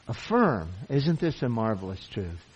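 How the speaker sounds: normal talking voice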